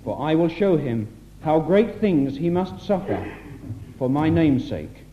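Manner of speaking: ordinary talk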